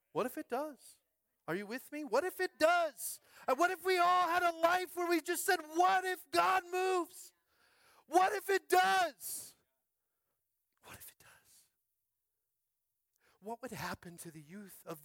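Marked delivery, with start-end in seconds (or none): 0.1-9.5 high, loud, slow fiery preaching; preaching at full force. Acted. Pastor to the congregation, soaring fiery preaching. 10.9-11.6 hushed breathy whisper. Acted. Pastor to the congregation, whispering the question. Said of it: "I don't know why I'm talking like this".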